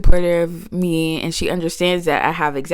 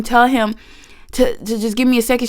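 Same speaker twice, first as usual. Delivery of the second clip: normal talking voice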